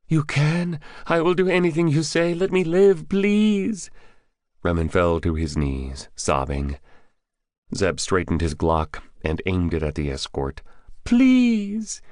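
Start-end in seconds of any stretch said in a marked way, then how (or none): 0.1-3.9 very high, desperate pleading; frantic high-voiced begging; begging for life. Captive begging for his life. 11.0-12.0 loud, very high cry for mercy; piercingly high, desperate plea; screamed plea. A narrator's reading. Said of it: "he screamed".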